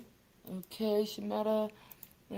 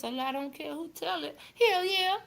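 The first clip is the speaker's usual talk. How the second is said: higher put-on Don voice, brash; 8 semitones higher, 3 dB quieter than usual. Host slips into her Don voice for chat. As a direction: play Don, cocky and loose. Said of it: "in my Don voice".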